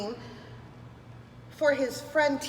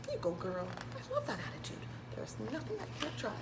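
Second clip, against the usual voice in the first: plain reading voice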